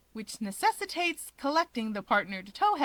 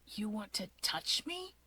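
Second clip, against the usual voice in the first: much softer, wary and taken aback; startled Shigaraki; 8 dB quieter than usual. Acted. Shigaraki to Natsuo, startled and wary. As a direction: ask in quiet confusion. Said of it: "Shigaraki startles".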